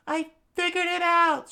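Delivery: very high, loud falsetto; high-pitched urgent cry; excited discovery. Acted. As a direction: shout your discovery in a high voice. Vivi announces her discovery.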